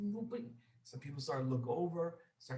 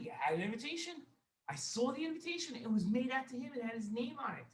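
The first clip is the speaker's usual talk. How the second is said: high-pitched, defensive insisting; insistent protest; 9 semitones higher than usual. Acted. Servant defends himself to his wealthy master, high insistent protest. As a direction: plead the facts, pitch jumping up.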